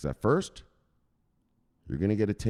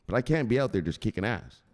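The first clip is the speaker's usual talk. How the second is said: ordinary talk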